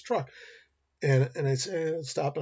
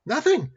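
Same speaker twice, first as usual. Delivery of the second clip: Nothing punched high and loud; Nothing stressed high and loud; emphatic answer; 11 semitones higher, 7 dB louder than usual. Shown as a demonstration. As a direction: hit Nothing hard as the answer. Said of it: "What did Buster do".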